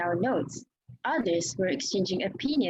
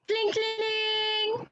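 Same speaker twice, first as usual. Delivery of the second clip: high sung bell sound cling cling; high imitated bell ringing; bell clang imitation; 13 semitones higher, much slower than usual. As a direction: ring it out like a handbell, high. Bell rung by narrator's voice.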